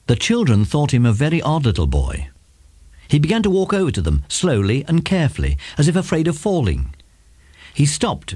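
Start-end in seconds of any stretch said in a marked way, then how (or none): none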